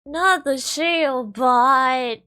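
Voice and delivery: slow, loud, high anguished wail; dramatic wail. Acted. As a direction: mourn it like a tragedy. Dismayed wail at build.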